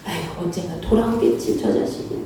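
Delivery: low, hushed, fading to breath; soft inner sigh. Retold or imitated. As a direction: think it to yourself, longing. Parent's inner hoping thought.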